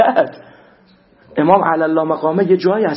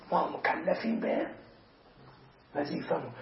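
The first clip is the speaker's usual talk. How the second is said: hushed whisper; 3 semitones lower, 17 dB quieter than usual. Real, not acted. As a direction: breathe the duty line almost silently. Solemn whispered key phrase.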